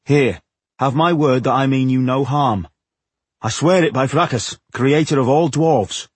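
solemnly insistent dwarf; earnest oath. A narrator's reading. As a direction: give your word earnestly.

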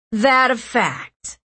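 slow, mocking drawl; sneering. A narrator's reading. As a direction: sneer the question. Hostile boy taunting captives.

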